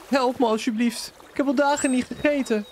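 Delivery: high, pleading beggar voice. Acted. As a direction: beg like a starving man.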